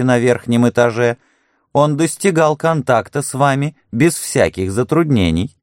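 high, sly mocking voice; mocking Harding. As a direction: needle the nurse with high irony. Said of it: "Хардинг ответил".

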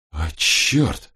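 mild shock, sighed out as an oath; exhaled curse. A narrator's reading. Robin sees what lies in the hole, exhaled curse. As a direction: curse let out on a breath. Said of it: "выдохнула Робин".

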